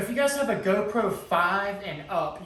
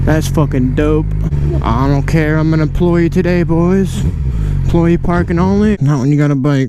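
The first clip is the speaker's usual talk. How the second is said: inside a helmet; 14 dB louder than usual. Real, not acted. Riding moto vlog in helmet mic.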